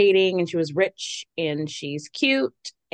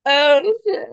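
loud, high surprised exclamation; 10 semitones higher, 9 dB louder than usual. Real, not acted. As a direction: blurt it out, startled. After a laugh, sudden realization.

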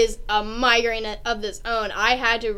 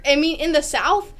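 ordinary talk

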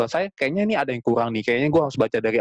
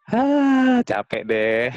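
mock exasperation; 9 semitones higher, 3 dB louder, much slower than usual. Mock exasperation at co-host's sponsor joke.

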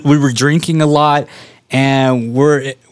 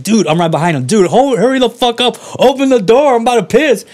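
rising to a frantic yell; desperate, begging him to hurry; urgent yelling; 8 semitones higher than usual. Retold or imitated. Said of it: "I was like".

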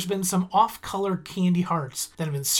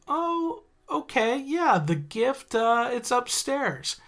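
higher, then a slow drawn-out stall; caught out; 7 semitones higher, slower than usual. Retold or imitated. Homer stalling to his son about a forgotten gift, flustered. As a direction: fake it like you remembered the gift.

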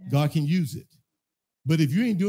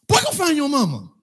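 put-on louder, higher exasperated snap at a kid; mock mad; 8 semitones higher, 6 dB louder than usual. Teacher fed up with a Cheeto-handed kid, mock exasperated. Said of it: "I got mad at him".

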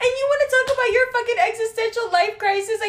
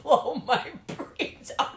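ordinary talk, nothing marked

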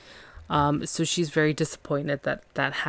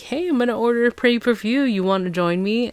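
imitating an upbeat, quick invite; higher, louder, hurried mock-friendly pitch; eager invitation; 6 semitones higher, 4 dB louder than usual. Sound guy asking her to join him, brightly eager.

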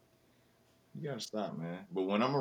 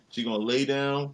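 ordinary talk

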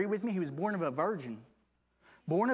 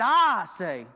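I say, said much louder with weight; louder emphatic; 7 dB louder than usual. Acted. Preacher quoting Jesus' authority, loud firm I say.

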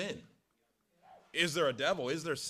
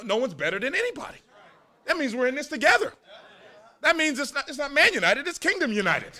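loud, high, driving sermon delivery; full-force preaching; 10 semitones higher, 7 dB louder than usual. Acted. Pastor preaching to the church, loud and fired up. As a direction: preach it out to the back row.

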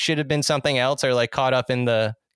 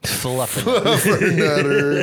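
laughing; 3 dB louder, much slower than usual. Real, not acted. Amused echo of the word.

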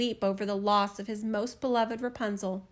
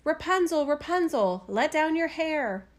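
calling up; 5 semitones higher than usual. A narrator's reading. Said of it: "went up to the tower and cried".

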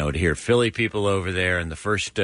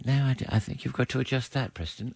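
English studio technician; 5 semitones higher, 4 dB quieter than usual. Retold or imitated. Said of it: "and then when they said".

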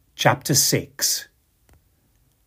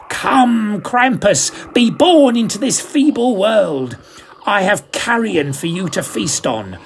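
manic cackling summons; 6 semitones higher than usual. Acted. Magician summoning a demon, cackling and commanding. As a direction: call up the demon with glee.

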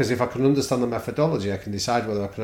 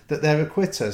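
normal talking voice

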